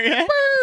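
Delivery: sudden high giggle at the joke; high burst of laughter. Real, not acted.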